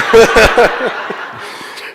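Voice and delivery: airy, high laugh at own slip; a breathless giggle at the mix-up; breathy laugh. Real, not acted. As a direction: laugh it off, embarrassed.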